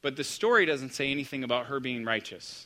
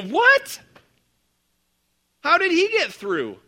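high, loud incredulous What?; shocked disbelief; 13 semitones higher, 8 dB louder, slower than usual. Retold or imitated. Pastor mimicking the crowd's shock at a stowaway boy, high incredulous. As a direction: squeal What? in disbelief, then press on. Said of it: "We go".

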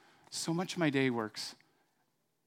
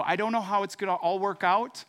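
faith declaration; 6 semitones higher, 7 dB louder than usual. Pretend. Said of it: "You go".

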